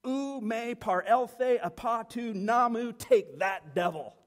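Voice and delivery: emphatic and exultant; triumphant defiance. Real, not acted. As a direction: proclaim the Greek like a victory blow. Triumphant climax of the denial.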